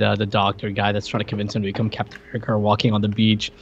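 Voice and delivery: ordinary delivery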